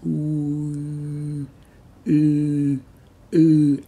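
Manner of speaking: "Ooh" said with spread smiling lips. Shown as a demonstration. Teacher demonstrating the smiling ooh vowel. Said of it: "So this is what happens".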